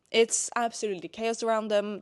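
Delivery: ordinary delivery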